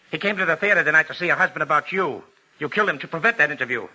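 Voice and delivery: fast, rising, pressing accusation; rapid accusation. Acted. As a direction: rush the charge home. Detective springs his accusation.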